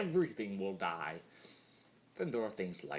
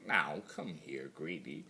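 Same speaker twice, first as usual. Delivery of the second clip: plain reading voice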